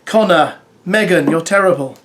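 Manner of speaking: ordinary talk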